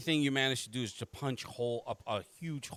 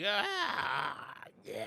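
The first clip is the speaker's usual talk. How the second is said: monster's cry; 3 semitones higher than usual. Acted. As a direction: wail like a lumbering cartoon monster. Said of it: "as it's just like".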